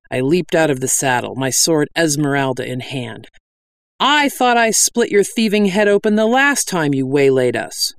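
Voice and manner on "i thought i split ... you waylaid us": raised, higher-pitched accusation; angry challenge. A narrator's reading. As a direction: hurl it up at an old enemy. Heroine shouts at her old foe.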